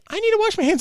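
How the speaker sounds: put-on high little boy; high loud child; high kid voice. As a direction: squeak it like a small child. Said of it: "the son's like".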